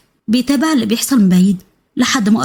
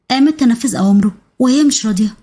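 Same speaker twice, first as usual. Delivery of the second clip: ordinary delivery, nothing marked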